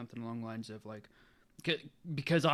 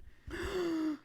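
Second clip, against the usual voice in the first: high shocked gasp; sharp gasp. Real, not acted. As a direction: gasp in disbelief. Reacting to the story's twist.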